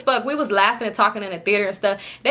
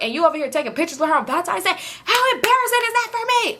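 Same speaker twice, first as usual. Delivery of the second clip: heated retold rant; 7 semitones higher than usual. Retold yelling at boyfriend.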